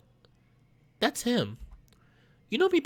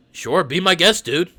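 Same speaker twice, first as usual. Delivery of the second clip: usual speaking voice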